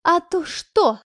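выше, нагло ухмыляясь; насмешливый вызов; с ухмылкой. A narrator's reading. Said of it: "ухмыльнулась". (Петрова нагло ухмыляется Ане)

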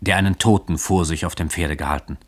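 ordinary delivery, nothing marked

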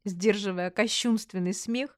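ordinary delivery, nothing marked

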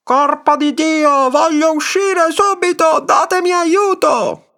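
very high, drawn-out scream for help; furious yelling. A narrator's reading. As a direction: scream to get out, stretching every word. (urla disperate e acutissime)

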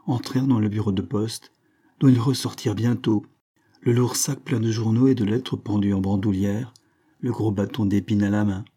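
ordinary delivery

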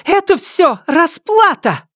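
very high, stretched-out cry; furious shriek. A narrator's reading. Wife clenches fists and stamps at the intruder, furious shriek. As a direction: shriek it slowly in a rage. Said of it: "Вспыхнув гневом".

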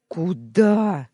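loud sharp blurt. A narrator's reading. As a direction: fire the question out. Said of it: "выпалил я".

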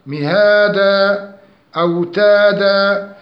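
مهادا، أوتادا chanted with two-beat long vowels; measured recitation of مهادا، أوتادا; natural madd. Shown as a demonstration. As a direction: hold each long a in مهادا، أوتادا evenly. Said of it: "أمد مد حركتين".

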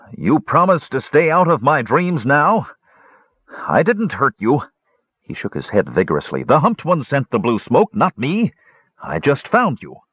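high, nervous dwarf voice; a frightened simple-minded dwarf pleading; scared simple dwarf. Acted. Frightened Garth protests to Flint, high nervous dwarf voice.

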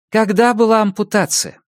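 brisk questioning doctor; female doctor voice. Acted.

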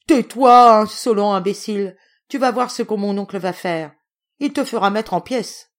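shouted rebuke settling into a threat; furious outburst. A narrator's reading. Aunt silencing the courier with threats, furious outburst.

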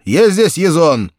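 loud, high, slow call. A narrator's reading. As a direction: call across the room. Astrogator calling Ezon.

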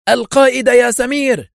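high, loud urgent shout. A narrator's reading. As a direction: shout it out to a comrade. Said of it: "وسمع أدهم يصرخ".

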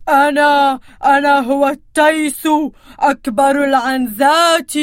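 loud, firm billy goat. Acted. Billy goat answering the troll, loud and bold. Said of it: "أعلى من صوته وأشد".

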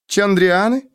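high, rising echo question; incredulous query. A narrator's reading. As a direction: ask it back in high-pitched disbelief. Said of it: "переспросила она". (удивлённо переспрашивая)

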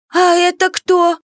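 put-on high, slow little boy question; small child voice. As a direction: ask like a curious child. Child asks about aunt.

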